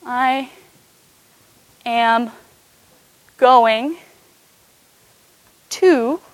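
each word set apart by long pauses; slow word by word. Shown as a demonstration. Teacher spells out the English sentence for the congregation, slow word by word. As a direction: lay out the sentence one word at a time for the church. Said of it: "if you wanted to say".

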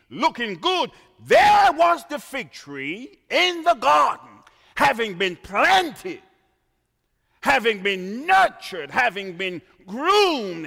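loud, high shouted litany; fervent loud sermon climax; preaching at full force. Acted. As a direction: hammer each phrase home. Preacher shouting repeated phrases.

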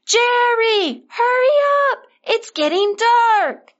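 high, drawn-out call into the distance. A narrator's reading. Parents calling their lagging son back down the mountain, high calling out. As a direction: call loudly to someone far back, voice pitched high. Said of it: "so they called to him".

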